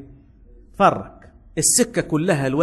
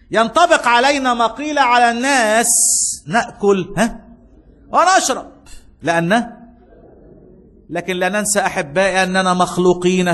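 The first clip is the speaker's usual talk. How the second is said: louder and higher, slow, half-chanted; full-force preaching; 4 semitones higher, 4 dB louder, slower than usual. Acted. Pastor exhorts the church to good works, loud and chanting.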